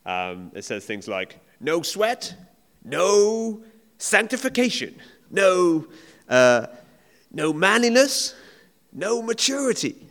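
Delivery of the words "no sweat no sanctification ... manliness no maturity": loud, high, slow mock American preacher; hammed-up American book voice, punching each no; American accent. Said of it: "Can't do the accent at all".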